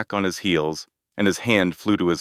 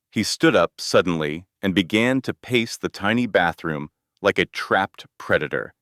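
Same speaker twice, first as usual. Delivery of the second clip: usual speaking voice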